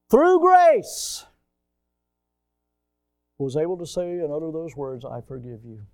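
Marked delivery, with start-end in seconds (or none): 0.1-1.2 through grace cried out high and loud; exclaimed. Acted. Preacher exclaims the key word. 5.1-5.8 hushed forgiveness. Retold or imitated.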